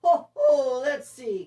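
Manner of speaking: booming high Ho, ho; belly-laugh like Santa; jolly Santa laugh. Acted. Santa chuckles while choosing reindeer, booming and jolly. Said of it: "continued Santa".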